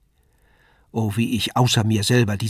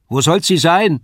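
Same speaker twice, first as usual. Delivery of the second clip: loud, high retort across the hall; calling back; 7 semitones higher, 8 dB louder than usual. A narrator's reading. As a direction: throw the question back at your husband. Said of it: "rief diese wieder".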